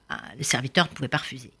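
fast, soft aside; hushed aside. A narrator's reading. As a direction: rattle it off under your breath. (aparté rapide et feutré)

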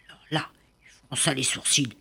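hushed storyteller whisper. A narrator's reading. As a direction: drop to a whisper to build suspense. Narrator whispers for suspense. (murmuré pour créer le suspense)

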